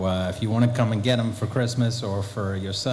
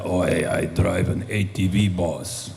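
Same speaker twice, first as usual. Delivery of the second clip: low, slow giant's rumble; a hint of Andre the Giant, easygoing; 5 semitones lower, slower than usual. Actor imitating Andre the Giant telling him about his ATV, lightly voiced. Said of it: "he said".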